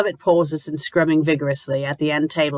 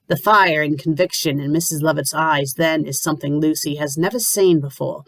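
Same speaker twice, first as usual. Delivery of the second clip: plain reading voice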